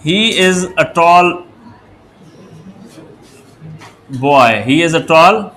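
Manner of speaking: very slow, word by word; teacher dictating an English sentence; slow dictation. Shown as a demonstration. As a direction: read the example slowly as if dictating. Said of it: "For example".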